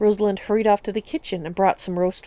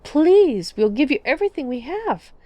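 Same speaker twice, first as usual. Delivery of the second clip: high, loud pleading; desperate plea; 7 semitones higher, 4 dB louder than usual. Acted. Mother begging the necromancers to save her daughter, desperate. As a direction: beg with everything you have.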